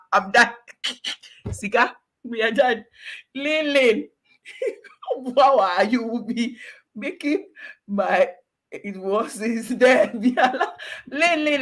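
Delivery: laughing through the words; giggling as she speaks. Real, not acted. Laughing while wrapping up.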